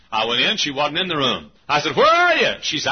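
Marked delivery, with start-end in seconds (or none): 2.0-2.5 loud, high searching holler; calling out. Retold or imitated. Preacher cannot find the maid, calling out loudly. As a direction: call through the rooms to find someone.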